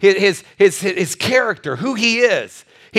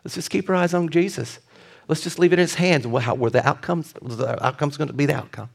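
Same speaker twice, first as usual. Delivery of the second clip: soft reassurance; 6 semitones lower, 6 dB quieter than usual. Retold or imitated. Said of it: "I said".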